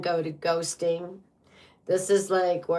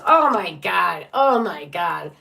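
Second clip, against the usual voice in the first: astonished exclamation; 7 dB louder, slower than usual. Real, not acted. Reader spots the same card returning, astonished. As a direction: exclaim in loud disbelief.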